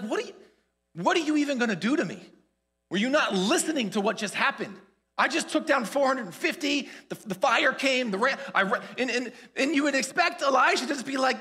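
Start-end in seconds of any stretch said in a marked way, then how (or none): 0.0-8.8 raised-pitch, scornful disbelief; incredulous retort. Acted. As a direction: throw the threat back, astonished. Imagined scornful retort to threat.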